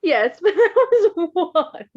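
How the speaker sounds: high, slow laughing protest. Real, not acted. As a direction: giggle through the words.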